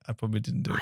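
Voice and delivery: breathy whispered chat; whispering. Real, not acted. Both whisper, ASMR chat.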